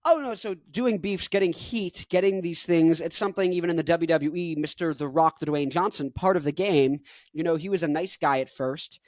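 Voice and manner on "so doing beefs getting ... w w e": ordinary talk, nothing marked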